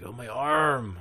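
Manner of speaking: hurt kid in a put-on Mexican accent; exaggerated Mexican-accented complaint. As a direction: whine it in a mock Mexican accent. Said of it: "The horrible accent".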